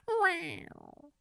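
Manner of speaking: very high tiny cat voice. Acted. Host as a cat, denying it's Mister Cuddles, in a tiny high voice. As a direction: squeak it like a cat. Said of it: "I'm Adolf Hitler, the cat".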